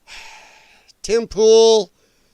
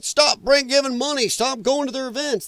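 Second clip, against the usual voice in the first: imitating a preachy pundit, higher; mock Candace quote; 6 semitones higher than usual. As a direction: parody her demands with disdain. Mocking Candace's calls to boycott.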